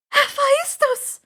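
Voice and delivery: high, loud drawn-out scream of the name. Acted. Annabeth realising who built the trap, screaming. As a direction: shriek the name in alarm. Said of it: "Annabeth screamed".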